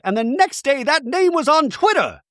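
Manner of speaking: high, emphatic reveal; mock outrage. Bronwyn revealing the nickname reached Twitter, outraged. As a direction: imitate an aggrieved accuser pitched well up. Acting Bronwyn's triumphant accusation.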